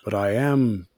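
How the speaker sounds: soft, measured, halting reproach; mild disapproval. Retold or imitated. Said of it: "he was like".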